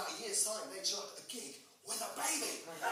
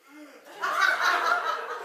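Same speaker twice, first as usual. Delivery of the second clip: mock orgasm gasp; 7 semitones higher, 9 dB louder than usual. Mimicking a woman's real orgasm.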